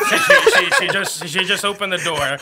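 laughing. Real, not acted. Laughing through the story.

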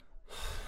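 short, soft weary exhale; exasperated sigh. Acted. Interviewer worn down by endless banter, sighing. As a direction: sigh as patience runs out.

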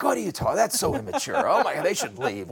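ugly American mode. Retold or imitated. New boss scoffing at staff staying late, mock indignant ugly American. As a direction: play the brash, dismissive American. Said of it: "So I go into ugly American mode".

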